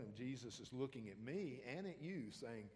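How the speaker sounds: ordinary talk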